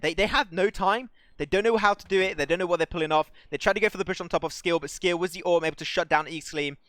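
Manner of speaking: high, loud opening that eases slightly; urgent play-by-play. Real, not acted. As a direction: hammer out the team's predicament. Live play-by-play under time pressure.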